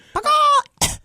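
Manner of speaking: loud, high chicken squawk breaking into a cough; chicken impression. Shown as a demonstration. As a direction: squawk like a chicken who smokes too much. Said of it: "really can do a chicken".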